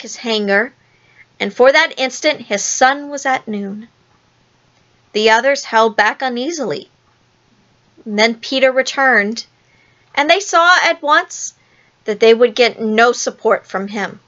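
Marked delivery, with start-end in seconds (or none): none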